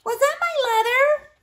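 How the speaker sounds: high squeaky little mouse; small timid mouse voice; tiny mouse voice. Acted.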